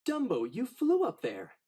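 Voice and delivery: excited cry. Acted. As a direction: burst out with the discovery. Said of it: "cried Timothy".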